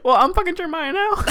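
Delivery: high, fast, laughing and smug; smug roleplay friend. Pretend. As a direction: gloat through a laugh. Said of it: "let's roleplay this".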